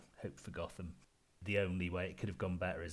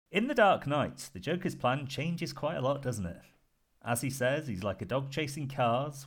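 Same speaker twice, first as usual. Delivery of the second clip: higher, lilting presenter read; show host announcing; 5 semitones higher, 4 dB louder than usual. Real, not acted. Host introducing the panel's villain contest, announcer style. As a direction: present the contest with showman's emphasis. Host's scripted segment introduction.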